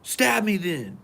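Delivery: high, dismissive brush-off; whatever, wave it off. Retold or imitated. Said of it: "he's like".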